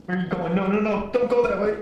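high, urgent alarm; panicked calling. Real, not acted. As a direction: call out in alarm, rising. Panicked call in dark game.

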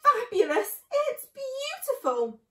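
high, loud, drawn-out ecstasy; breathless rapture. Acted. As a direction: burst out in halting rapture. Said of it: "fighting for breath".

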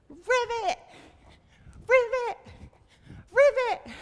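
high, loud croak-word on a jump; frog ribbit. Pretend. Teacher hops with children as a frog, loud high ribbit. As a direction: leap and burst it out like a frog. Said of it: "jump up and say ribbit".

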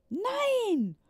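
put-on high, loud chorus of boys; boys shouting. Boys answering Juliane, high loud shout. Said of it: "riefen alle Buben".

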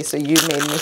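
ordinary talk, nothing marked

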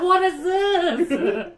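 slow, high, drawn-out confusion; mock confused guy. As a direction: stretch it out like a baffled guy. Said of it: "He's like".

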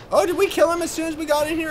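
normal talking voice

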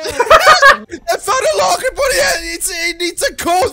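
frantic shouting on the run; being chased. Real, not acted. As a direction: scream in panic. Chased by monster, yelling.

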